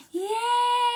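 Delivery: very high yay; high excited yay; high cheer. Real, not acted. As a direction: cheer at the song end. Cheering after song.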